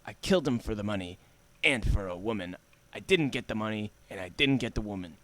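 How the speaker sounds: low, soft noir film voice-over; grim hard-boiled narrator, quietly. Acted. Reading a noir film opening.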